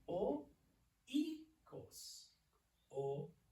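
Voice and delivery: "oikos" sounded out in separate syllables with pauses; syllable-by-syllable pronunciation. Shown as a demonstration. Teacher introduces a new Greek word to class, syllable by syllable. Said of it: "this is the word".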